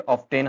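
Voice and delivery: OFTEN said "off-TEN", T sounded; common mistake. Shown as a demonstration. Teacher recalling the common mistake, often said as off-ten. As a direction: say "often" with a full "ten" at the end. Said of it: "common mistake आपको मैंने बता दी".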